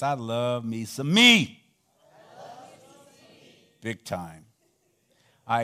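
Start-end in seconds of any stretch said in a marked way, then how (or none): 0.0-1.6 slow emphatic recital. Shown as a demonstration. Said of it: "Say this".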